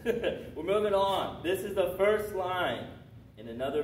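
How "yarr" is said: high, loud pirate "yarr". Shown as a demonstration. Teacher asking a student for a pirate sound, high pirate yarr. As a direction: let out a long pirate growl. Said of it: "what's a pirate sound like".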